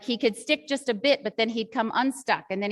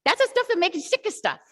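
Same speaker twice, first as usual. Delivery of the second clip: mock Cockney kid, pitched much higher; high, exaggerated imitation of a Cockney Brit; Cockney British accent; 10 semitones higher than usual. Teacher mimicking a first-grade pupil's answer, in a high Cockney accent. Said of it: "he said in his accent".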